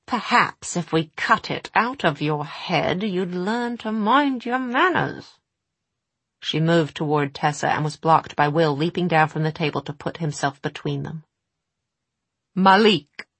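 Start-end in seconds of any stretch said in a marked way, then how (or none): 0.1-5.4 menacing witch voice. Acted. 12.5-13.3 loud, sharp invocation; commanding call. Acted. Will summons his blade.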